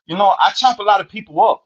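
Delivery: high, far-off mock voice of the Salvadoran inmate in broken English; imitating his accent. Retelling a cellmate's words to the host, high mock accent. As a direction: pitch it up high and foreign, like the little homie. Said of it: "that's how he talk".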